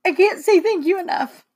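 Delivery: high, choked-up thanks; trying not to cry. Real, not acted. Daughter overwhelmed thanking her mother, holding back tears. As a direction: voice rising with real tears. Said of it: "I'm trying not to cry".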